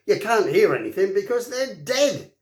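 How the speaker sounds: gleeful that the danger's over; joyful. A narrator's reading. Thuggery joyfully reassures Hiccup the dragons are dead. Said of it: "said Thuggery joyfully".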